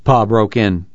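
usual speaking voice

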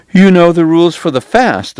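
surprised. Acted. The priest, surprised at Doc, surprised. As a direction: priest caught off guard, voice lifting. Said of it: "with some surprise".

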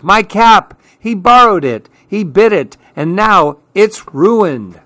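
high-pitched complaint, slowing at the end; outraged tattling, easing down in pitch; crying out. A narrator's reading. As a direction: protest like an upset child. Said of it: "cried Clark".

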